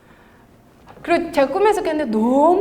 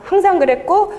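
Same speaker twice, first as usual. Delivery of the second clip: ordinary delivery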